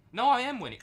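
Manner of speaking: loud, high jolt of surprise; sudden realisation. Real, not acted. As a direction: burst out as you spot the win. Discovers he was winning after all.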